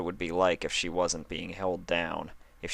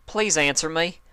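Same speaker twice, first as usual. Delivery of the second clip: higher, louder pleading; desperate plea; 10 semitones higher, 5 dB louder than usual. Acted. Applejack begs her dazed friend to answer, loud and desperate.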